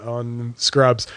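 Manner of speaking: ordinary talk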